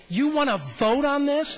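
Kermit's disbelief. Retold or imitated. Kermit incredulous at him.

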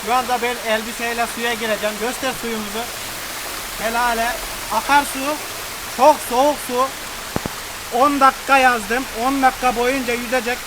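high, loud excited chatter; excited shouting. Real, not acted. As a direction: shout it over the cold water. Standing in ice cold water, excited. (heyecanla bağırarak)

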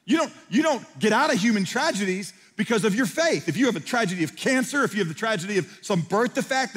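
loud, high sermon shouting; fiery preaching. Acted. Pastor warns the congregation about tragedy, shouting. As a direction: preach it out hard to the crowd.

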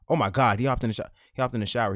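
loud, astonished outburst; amused disbelief. Real, not acted. Streamer seeing him hop in the shower, loud disbelief. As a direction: blurt it out to your viewers. Streamer reacting to sudden game event.

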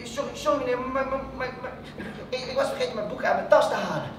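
hesitant, stuttering schoolboy excuse; nervous stammer. Acted. Pupil apologising to the teacher about his books, nervous stammer. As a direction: stumble and repeat words anxiously.

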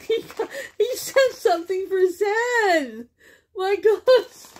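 high, laughing shout of joy; laughing delight. Real, not acted. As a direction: laugh out the surprise. Gift for her dog.

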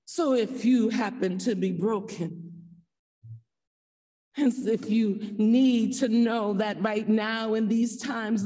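usual speaking voice

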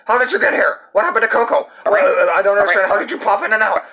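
squeaky puppet blurting out confusion; high excited puppet voice. Acted. Puppet baffled by the vanished cat, high and flustered.

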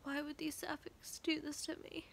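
small, quiet, high lament; tearful. Real, not acted. Reader asking why the book did this, tearful. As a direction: softly plead through tears. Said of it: "going to cry like this".

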